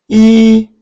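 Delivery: 이 held high, loud and level; first-tone demo. Shown as a demonstration. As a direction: sustain 이 on one high pitch. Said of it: "일 성입니다".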